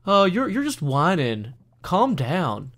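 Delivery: imitating people who wave off someone's problem, dismissive; dismissive scoff. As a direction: scoff at a whiner, pushing the complaint away. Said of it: "Versus everybody going".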